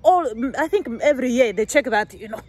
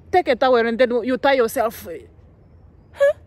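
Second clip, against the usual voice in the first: ordinary delivery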